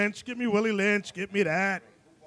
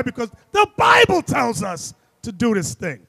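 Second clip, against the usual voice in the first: shouting; 3 semitones higher, 7 dB louder than usual. Real, not acted. Preacher exclaims the reason.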